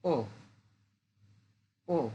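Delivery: ও short fall; Mandarin fourth tone. Shown as a demonstration. Teacher drills the fourth tone on ও, falling. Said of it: "fourth tone".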